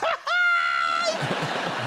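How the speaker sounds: very high imitated squeal; mum's proud shriek. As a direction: shriek like an overexcited mother. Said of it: "she'd be kind of".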